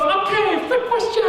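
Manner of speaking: put-on high-pitched excited Chinese-accented examiner; hammed-up Chinese accent, very high; thick Chinese accent. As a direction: announce it as a thrilled Chinese lady. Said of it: "she had a really thick Chinese accent".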